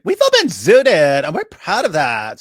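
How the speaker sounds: put-on high, drawn-out valley girl voice; sing-song valley girl mockery. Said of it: "Valley Girl would be like".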